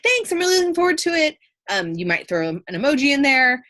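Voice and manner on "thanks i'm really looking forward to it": high, upbeat casual thanks; casual, informal. Shown as a demonstration. Teacher showing casual word choice in an email, informal and upbeat. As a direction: sound like you're texting somebody, pitched up. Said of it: "Casual would be more like".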